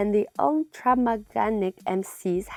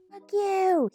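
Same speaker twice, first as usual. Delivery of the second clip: high-pitched slurred holler, drawn-out vowel; slurred yell; 11 semitones higher than usual. A narrator's reading. Man yelling from the drug den's window, slurred shout. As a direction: yell it high with a drunken slur. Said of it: "he yelled, his voice slurred".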